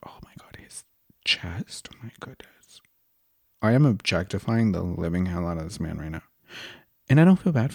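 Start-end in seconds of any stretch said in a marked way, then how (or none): 0.1-2.8 hushed awed whisper. Real, not acted. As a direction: whisper in awe, barely voiced. Gushing over his chest.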